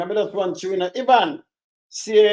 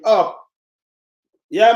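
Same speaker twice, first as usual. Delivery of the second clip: normal talking voice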